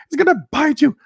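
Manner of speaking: high, panicky mock warning; mock alarm at a spider; imitating frightened friend. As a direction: squeal it like she's scared.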